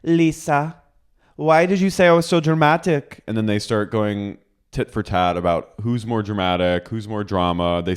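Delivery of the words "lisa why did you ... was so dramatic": high-pitched imitation of an aggrieved housewife; mock confrontation. Housewife confronting her castmate, mock-aggrieved high voice. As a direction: demand an explanation in a put-on high voice. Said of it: "And she goes".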